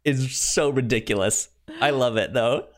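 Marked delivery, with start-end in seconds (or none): none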